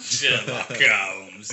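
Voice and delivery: very slow, mocking the name; mocking drawl. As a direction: stretch it out to mock. Mocking the other's answer.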